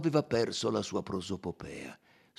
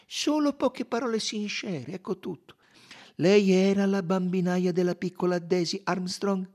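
higher, clear detective voice; coaxing detective; 9 semitones higher, 6 dB louder than usual. Acted. Detective questioning the weeping nursemaid, coaxing. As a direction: coax out a confirmation.

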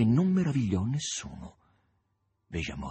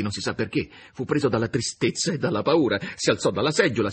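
usual speaking voice

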